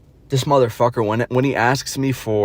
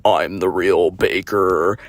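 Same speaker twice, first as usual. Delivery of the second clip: slow, low creaky drawl; annoying fry voice; 4 semitones lower, much slower than usual. Retold or imitated. Mimicking him coming up to brag, fry voice. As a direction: drag it out in grating vocal fry. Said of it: "this annoying ass fry voice".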